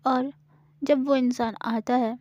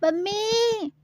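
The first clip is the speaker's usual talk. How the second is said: much higher frightened shriek of Mummy; crying out for mummy; 10 semitones higher, much slower than usual. Acted. Gauri, scared again, cries out for her mother. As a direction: scream it for help. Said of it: "ऐसे बोलने लगती है".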